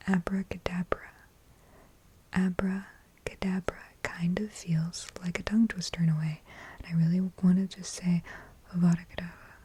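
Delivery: gentle soft-spoken ASMR; soft close murmur, barely voiced. Shown as a demonstration. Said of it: "talk in my soft-spoken voice".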